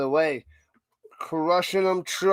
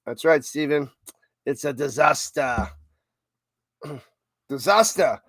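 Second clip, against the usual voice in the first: usual speaking voice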